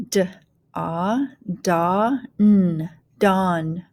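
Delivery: "dawn" built up sound by sound; teacher blending "dawn" with gaps between sounds; phonics blending. Shown as a demonstration. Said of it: "go ahead and start blending".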